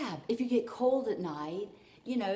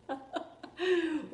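high giggly laugh; light burst of giggles; self-amused laugh; 10 semitones higher than usual. Real, not acted. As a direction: laugh at yourself, tickled. Laughs at herself in the hat.

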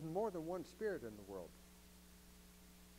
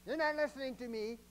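higher, a touch more forceful; forceful wife; 8 semitones higher, 4 dB louder than usual. Retold or imitated. Wife scolding distracted husband, mildly forceful. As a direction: press the point like a fed-up wife. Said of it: "get a little more forceful about it".

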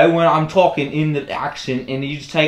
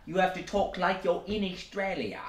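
put-on Australian accent; 5 semitones higher, 4 dB quieter than usual. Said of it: "you're talking a little Britishy".

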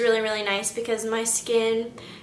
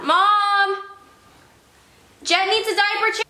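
high call to another room; 9 semitones higher, 3 dB louder than usual. Real, not acted. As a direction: call out to mom. Calling out to mom.

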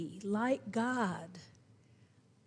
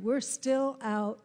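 normal talking voice

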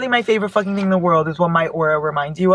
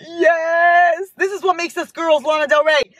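much higher, excited cheering; excited squeals; 11 semitones higher than usual. Real, not acted. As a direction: cheer each song name. Finds a playlist of favourites.

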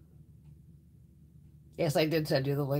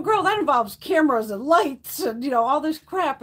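louder and slower, drawn-out complaining; mock protest; 8 semitones higher, 8 dB louder than usual. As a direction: push back like a fed-up friend, slowly. Retold arguing with friend.

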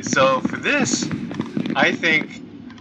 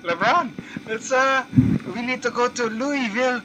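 put-on terrible Filipino accent; 7 semitones higher, 3 dB quieter than usual. Gamer voices a Pacquiao character asking LeBron for help, mock Filipino accent. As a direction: play a bad Pacquiao-style accent. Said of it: "It's a terrible accent if you know that accent".

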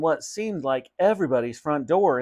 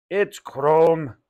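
correcting; 3 semitones higher, 4 dB louder than usual. Acted. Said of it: "corrected Mother".

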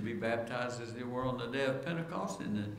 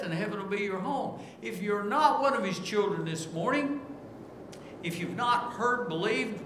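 preaching at full force; 7 semitones higher than usual. Acted. Preacher pressing the congregation toward repentance, rising fervor. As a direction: plead with the congregation, climbing in pitch.

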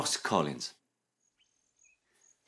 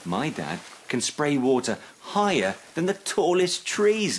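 high, climbing boast; gushing; 6 semitones higher than usual. A narrator's reading. Child bragging about dad spraying water, gushing. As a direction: gush with pride, peaking at the end. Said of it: "gushed Trunkle".

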